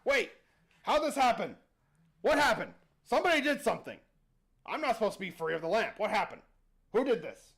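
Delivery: loud, rapid-fire bewildered questions; bewildered genie. Acted. Freed genie asks the crowd what happened, bewildered. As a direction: demand answers in total confusion. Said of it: "he's looking around, goes".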